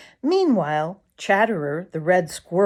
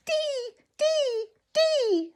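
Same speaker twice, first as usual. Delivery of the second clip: faint cheerful chirp; much slower than usual. Acted. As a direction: chirp it faintly like a weak little bird. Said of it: "said Tommy Tit faintly, but cheerfully".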